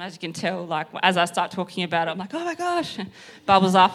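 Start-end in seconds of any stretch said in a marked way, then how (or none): 2.3-3.0 high-pitched thrilled gush; excited. Acted. Speaker showing how evangelism excites her, giddy delight. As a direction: demonstrate bubbling-up excitement. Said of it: "I get really excited about evangelism".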